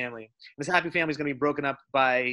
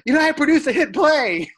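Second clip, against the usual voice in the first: boastful laughing quote; 10 semitones higher, 8 dB louder than usual. Retold or imitated. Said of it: "quoting Rushmore line".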